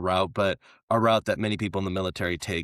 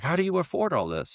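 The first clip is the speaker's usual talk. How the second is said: higher, curious question from a visiting politician; impressed Mexican politician; 10 semitones higher than usual. Retold or imitated. Visiting Mexican politician asking the American host, lightly impressed. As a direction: ask it with mild wonder. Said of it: "a politician from Mexico's like".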